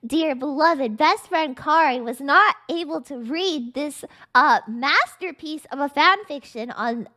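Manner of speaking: loud, hyped-up announcing; big grand showman voice; excited. Real, not acted. Hyped stream intro to viewers.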